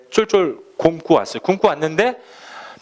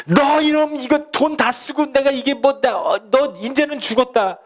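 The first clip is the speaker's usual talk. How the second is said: angry father scolding; 9 semitones higher than usual. Pretend. Father yelling at returning prodigal son, furious. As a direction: berate the son who wasted it all. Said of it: "이랬어요?".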